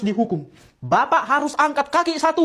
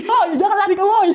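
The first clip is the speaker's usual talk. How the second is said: yelling after thief; 7 semitones higher, 4 dB louder than usual. Acted. Villager yelling at a fleeing thief to stop, shouting. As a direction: shout it higher and louder after a runner.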